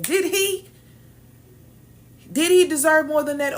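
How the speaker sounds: loud, high-pitched disbelief; incredulous challenge. Real, not acted. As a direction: throw the question back, loud and high. Challenging the crowd's claim.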